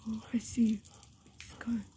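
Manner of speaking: low, very soft near-whisper; hushed realization. Pretend. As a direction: drop to a low hush. Dog voice, target has vanished.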